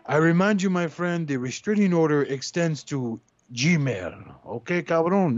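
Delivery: Sencho persona. Pretend. Answers letter as Sencho persona.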